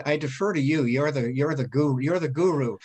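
ordinary delivery

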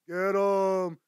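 loud, drawn-out "get 'em"; mock attack call. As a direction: sic them on someone, loudly. Catchphrase ending mock insult.

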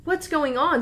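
high, loud retold panic; mock alarm. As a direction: cry out in acted alarm. Retold panic after balcony jump.

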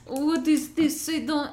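put-on lightly done vague Eastern European accent; hint of a put-on Eastern European voice. Guest imitates locals in a light Eastern European accent. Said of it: "a Eastern European, like, vague".

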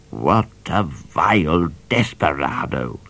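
slow, odd judge voice, a mild snarl. A narrator's reading. Judge snarling after Midnight, mild snarl. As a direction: spit it after him. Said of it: "snarled Judge Pepper".

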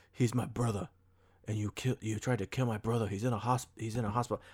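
lightly attempted British tough-guy accent; Jason Statham impression. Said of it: "that's my Jason Statham impression".

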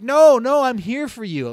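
high, loud mock reassurance; retold insistent protest. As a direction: protest hurriedly, acting out your own defence. Acts his protest at being accused.

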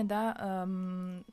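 usual speaking voice

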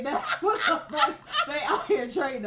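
loud, laughing through the words; cracking up mid-sentence; loud laughter spilling into the line. Real, not acted. Cracking up at own joke.

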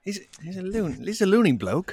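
a jokey attempt at a British bloke; lightly put-on British accent. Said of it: "That was British".

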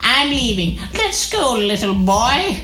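very high cartoonish anime girl voice; rejected anime character. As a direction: squeak it out like an anime heroine. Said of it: "she's still gonna have that voice though".

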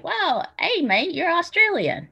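high mock Australian accent, Well, hey, mate; playful imitation of an Australian accent. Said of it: "if somebody called you from Australia".

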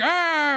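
high, loud commercial yelp; a hammed-up scream from a TV ad; mock scream. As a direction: yelp like the panicked ad guy. Mocking a soda commercial.

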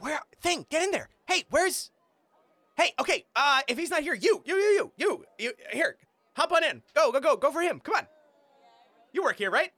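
high, loud and flustered stagehand; frantic halfling. Acted. GM voicing a halfling stagehand shoving Mick toward the fight, panicked. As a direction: hurry everyone along in a panic.

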